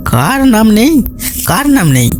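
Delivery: high, startled repeated question; অবাক colonel. A narrator's reading. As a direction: ask in surprise, pitch leaping up. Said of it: "অবাক হয়ে জিজ্ঞেস করলেন". (অবাক হয়ে চড়া গলায়)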